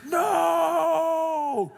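high, loud shrieking no; imitated child's scream. Daughter screaming at dad not to touch it, shrieking.

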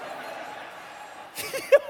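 high breathless laughter; real laugh. Real, not acted. Telling the audience about losing both toys, laughing high. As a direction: laugh at the absurd loss.

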